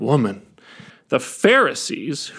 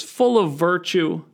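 usual speaking voice

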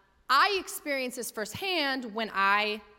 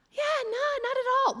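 much higher, fast, overly chipper yes; forced politeness; much faster than usual. Retold or imitated. Pastor reluctantly agreeing to the trainee, forced chipper. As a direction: fake a cheerful okay.

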